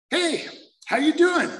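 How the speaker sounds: chirpy high hello from a newcomer's neighbour; friendly stranger. Retold or imitated. Stranger greets him at the meeting, high and friendly.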